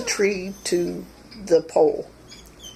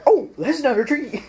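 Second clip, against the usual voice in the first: higher, lightly voiced squirrel catching its mistake; realizing squirrel; 8 semitones higher, 5 dB louder than usual. Squirrel realizes the narrator is not a tree, lightly voiced. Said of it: "and it's like".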